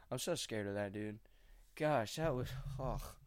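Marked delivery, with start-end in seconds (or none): none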